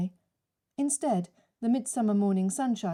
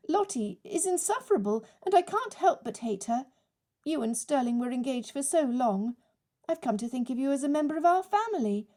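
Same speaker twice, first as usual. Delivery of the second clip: raised, rushed, flustered chatter; babbling; 6 semitones higher than usual. Acted. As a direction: babble to cover the embarrassment. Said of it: "she began to babble".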